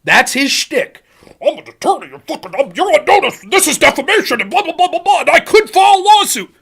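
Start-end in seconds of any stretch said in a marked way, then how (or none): 1.4-6.5 mocking tough-guy lawyer, rising in pitch; Pisco. Streamer mimicking an attorney threatening lawsuits, mock tough-guy bluster. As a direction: bluster hammed-up legal threats, climbing to a peak. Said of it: "He's a fucking tough guy. He's a crybully. That's his shtick".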